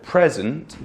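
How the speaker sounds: "present" as the noun, PREZ-ent; first-syllable stress. Shown as a demonstration. Teacher explaining the noun, "present" stressed at the front. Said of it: "you were talking about a noun".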